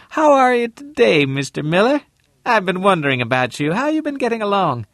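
high female voice, quickening; woman's voice. Acted. Mrs Lane voiced high.

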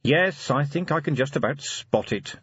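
a touch of weary patience. A narrator's reading. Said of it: "said Vimes wearily".

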